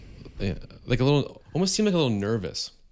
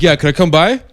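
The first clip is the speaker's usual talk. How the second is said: higher, louder easygoing ask; imitating Momoa; 8 semitones higher, 15 dB louder than usual. Jason Momoa asking to visit the store, loud casual mock ask.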